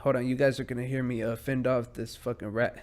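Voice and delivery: usual speaking voice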